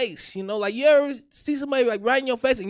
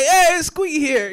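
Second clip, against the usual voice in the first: loud, raised-pitch mock greeting; mimicked friends' greeting; 5 semitones higher, 7 dB louder than usual. Everybody greeting him by nickname, loud mock cheer. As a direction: call out the nickname like teasing friends. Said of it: "everybody be like".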